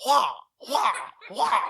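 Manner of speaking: high loud martial arts yells; kung fu cries. Retold or imitated. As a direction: shout it like a movie kung fu fighter. GM voicing kung fu fighter.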